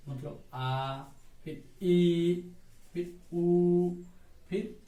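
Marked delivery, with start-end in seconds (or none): 1.4-3.9 ई and ऊ stretched out long and loud; teacher stretching ई and ऊ to show long vowels; drawn-out long vowels. Shown as a demonstration. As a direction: hold each long vowel ई, ऊ to show it is दीर्घ. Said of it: "दीर्घ मतलब बड़ा".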